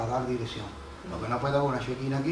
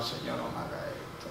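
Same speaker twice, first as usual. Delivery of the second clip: Peter weeping; 9 dB quieter than usual. Retold or imitated. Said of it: "Pedro de ahí llorando".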